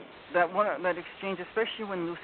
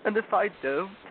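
higher acted tearful protest; starting to cry; 6 semitones higher, 4 dB louder than usual. Fawn fears refusing the witch, tearful. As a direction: let the voice climb toward tears. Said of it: "beginning to cry again".

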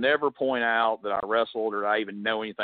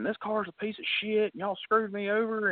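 mock angry yelling; 11 semitones higher than usual. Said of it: "they're yelling and cussing".

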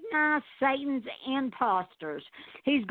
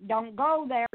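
normal talking voice

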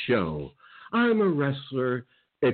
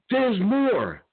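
taunting; 10 semitones higher than usual. Real, not acted. Taunting his enemies.

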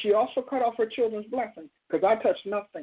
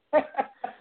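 short laugh; 7 semitones higher than usual. Real, not acted. Pastor laughing at birthday joke.

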